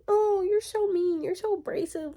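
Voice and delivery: high-pitched whiny imitation of critics; mock whining. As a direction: mimic them as whining babies. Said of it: "literally just whining".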